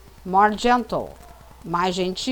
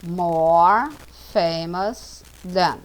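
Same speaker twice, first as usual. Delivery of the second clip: slow "More famous than", one word at a time; slow teaching model; 3 semitones lower, much slower than usual. Shown as a demonstration. Teacher models the comparative for students, slow and careful. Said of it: "Spider-Man is famous".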